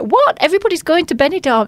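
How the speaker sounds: high squeak of disbelief at Benidorm gigs; mock disbelief. To his dad about tribute acts, a high mock disbelief. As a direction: act stunned, pitch leaping up. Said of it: "I'm like".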